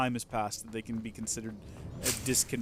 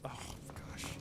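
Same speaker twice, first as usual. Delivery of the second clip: whispered; 14 dB quieter than usual. Real, not acted. Embarrassed aside.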